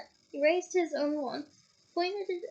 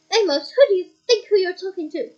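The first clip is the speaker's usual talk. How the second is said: loud, higher angry rebuke; furious defence of the boy; father figure angrily rebuking a colleague; 5 semitones higher, 12 dB louder than usual. Acted. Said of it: "very angrily".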